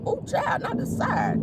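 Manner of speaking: clutching pearls, high and hushed; scandalized high gasp. Real, not acted. Scandalized by gossip detail.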